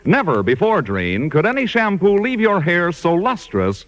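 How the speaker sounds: ordinary delivery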